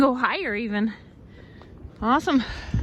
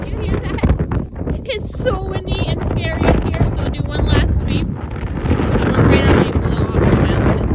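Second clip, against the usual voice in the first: raised voice over a gale; shouting over wind; 7 semitones higher, 5 dB louder than usual. Real, not acted. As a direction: call out over the roaring wind.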